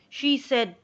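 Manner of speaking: ordinary delivery, nothing marked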